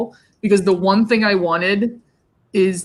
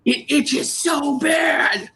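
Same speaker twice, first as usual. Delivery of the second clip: higher, drawn-out comic wail; slow, strained mock complaint; mock whining; 6 semitones higher, much slower than usual. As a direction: imitate a friend wailing in misery. Voicing friend's itchy misery.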